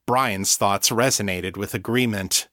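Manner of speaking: plain reading voice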